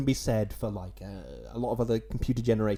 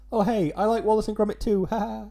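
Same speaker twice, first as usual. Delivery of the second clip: higher, louder chirpy post; mock eager fan; 7 semitones higher, 5 dB louder than usual. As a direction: gush like a keen listener, hammed-up. Acts out a listener's cheery post.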